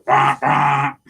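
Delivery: loud honking bah bah; mock horn honk. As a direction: honk like a goose out the window. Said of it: "stick your head out the window and go".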